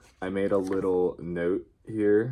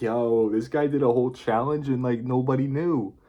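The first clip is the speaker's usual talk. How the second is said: hyped viewer voice; 5 semitones higher, 5 dB louder than usual. Retold or imitated. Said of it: "and they're like".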